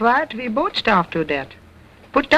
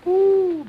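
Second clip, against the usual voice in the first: high squeaky cracked note; dummy's voice cracking mid-song; 10 semitones higher than usual. Acted. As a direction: let the voice crack way up. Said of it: "what a voice crack".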